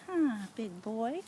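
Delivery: normal talking voice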